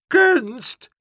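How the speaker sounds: very high, loud outraged shriek; scandalised high-pitched yell; shouting. A narrator's reading. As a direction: shout it back in disbelief. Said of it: "schreeuwde mevrouw Helderder".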